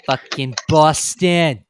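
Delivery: put-on slow, loud, drawn-out Boston accent; bad Boston accent. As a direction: ham up a Boston accent, stretched out. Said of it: "that was a bad Boston impression".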